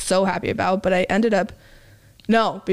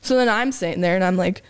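ordinary talk, nothing marked

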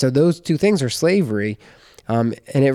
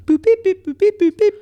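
high, chirpy beep-boop dialing noises; phone dialing beeps; 5 dB louder than usual. Pretend. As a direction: sing out the phone keypad tones. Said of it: "I'm gonna call him".

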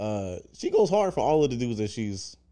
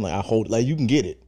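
normal talking voice

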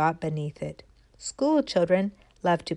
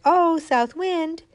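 acorn calling to the wind; 5 semitones higher, 4 dB louder than usual. Acted. Little acorn calling up to the north wind, pleading. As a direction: call up hopefully to the wind. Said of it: "the little acorn said".

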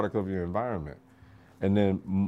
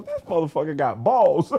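laughing drill instructor; 7 semitones higher, 3 dB louder than usual. Retold or imitated. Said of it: "And they go".

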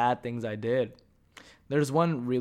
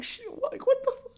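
much higher, startled squeal; shocked squeak. Retold or imitated. Storyteller playing himself caught off guard by friends, squealing. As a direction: jump way up in pitch, mock alarmed. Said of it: "And I'm like".

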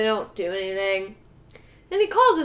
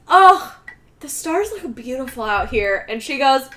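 loud, higher, exasperated whine; loud whiny girlfriend; 6 semitones higher, 9 dB louder than usual. Retold or imitated. Girlfriend on the phone dismissing the stars, loud whine. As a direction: whine at him like a spoiled girlfriend.

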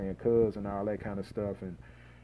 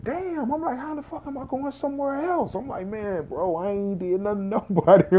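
much higher, exasperated outburst, laugh at the end; disbelief; 9 semitones higher than usual. Retold or imitated. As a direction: throw up your hands in disbelief. Exasperated at being moved again.

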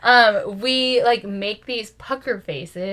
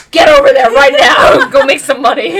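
loud, laughing push to go; loud bossy husband; 4 semitones higher, 17 dB louder than usual. Retold or imitated. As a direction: shout it at her, half laughing. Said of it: "Aaron would be like".

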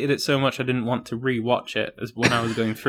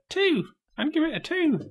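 very high, tiny put-on voice; Kit. Podcaster speaking Kit's score for him, very high little voice. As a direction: pitch it way up, as if for a little one. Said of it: "He said".

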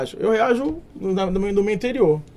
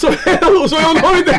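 high, loud laughing; 8 semitones higher, 7 dB louder, slower than usual. Real, not acted. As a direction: laugh through the words. Joke makes them laugh.

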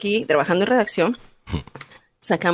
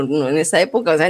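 normal talking voice

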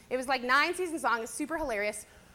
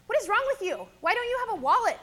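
exasperated mom, pitched up and louder; mock freak-out; 7 semitones higher, 4 dB louder than usual. Mom scolds her son for having no wallet, exasperated. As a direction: scold him like a fed-up mother. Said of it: "she's kind of freaking out on him".

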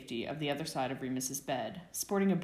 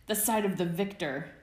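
gleeful, louder reply; jovial exclamation; 3 semitones higher, 5 dB louder than usual. Acted. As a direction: exclaim it with manic glee. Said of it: "Peter exclaimed jovially, maniacally".

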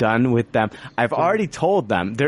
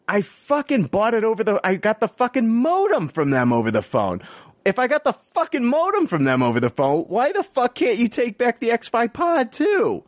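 high, strained, disbelieving rant; a higher-pitched exasperated tirade at the store; incredulous outrage; 10 semitones higher than usual. Retold or imitated.

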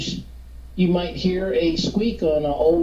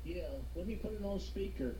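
distant speakerphone voice; faint voice through the phone; phone speaker; 19 dB quieter, faster than usual. Real, not acted. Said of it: "can you get close to the phone?".

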